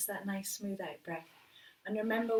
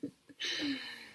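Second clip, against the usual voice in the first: light laugh; 6 semitones higher than usual. Real, not acted. Laughs after demo.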